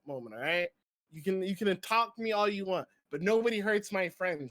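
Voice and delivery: ordinary delivery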